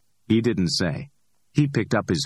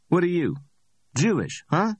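higher, amused street hustler voice; cocky Ordell; 12 semitones higher than usual. Acted. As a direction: play Ordell, high and pleased with himself. Ordell needles Max.

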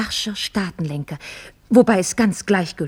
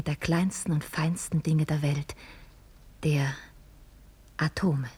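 lowered reverent whisper; 4 semitones lower, 6 dB quieter, slower than usual. Acted. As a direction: drop to a whisper of awe. Awed hush about atoms.

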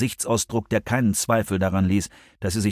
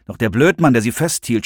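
ordinary delivery

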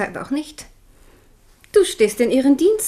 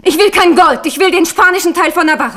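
raised, higher, louder refusal; indignant refusal; 6 semitones higher, 7 dB louder than usual. Acted. Queen refusing gold, indignant and loud. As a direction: throw the offer back in her face.

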